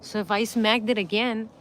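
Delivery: ordinary delivery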